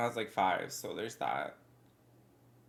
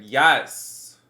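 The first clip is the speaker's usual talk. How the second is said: loud; 13 dB louder than usual. Real, not acted. Emphatic answer to test question.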